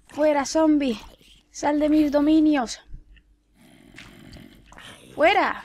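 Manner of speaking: high, mock-imperious order; commanding. Real, not acted. Player banishing a zombie from her domain, commanding. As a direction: order the intruder out.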